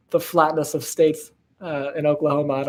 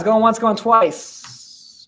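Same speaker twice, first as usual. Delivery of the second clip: drawn-out auction call; 5 semitones higher, much slower than usual. Acted.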